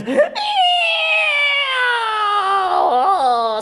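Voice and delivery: long high squeal; witch squeal. Acted. The witch splashed with water, long high squeal. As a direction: squeal in shock like a melting witch. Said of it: "Squealed the witch in surprise".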